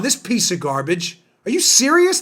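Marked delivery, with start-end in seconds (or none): 1.5-2.2 much higher, disbelieving question; can't believe what he's hearing; incredulous. Real, not acted. Host outraged at the rule, high incredulous.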